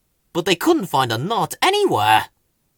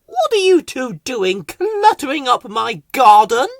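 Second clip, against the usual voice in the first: much higher, louder haughty voice; high, louder indignant voice; haughty Rose; 10 semitones higher, 7 dB louder than usual. Acted. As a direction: scold them grandly. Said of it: "asked Rose".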